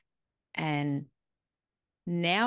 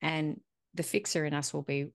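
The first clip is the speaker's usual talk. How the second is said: ordinary talk, nothing marked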